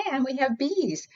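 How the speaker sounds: usual speaking voice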